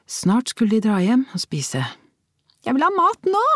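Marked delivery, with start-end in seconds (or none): 2.7-3.4 put-on much higher whining little girl; hungry little girl whining at mum; whiny child. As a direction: whine like a hungry small child. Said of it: "den sutrete klangen i Theas stemme".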